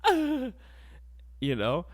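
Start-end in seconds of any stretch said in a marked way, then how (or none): 0.0-0.5 high, loud squeaky refusal; mock reluctant woman. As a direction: squeal a put-upon no no. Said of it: "she's like".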